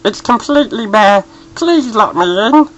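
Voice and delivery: voice of someone wearing clothes. A narrator's reading. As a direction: sound stiff and overdressed. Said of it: "as if it came from someone wearing clothes".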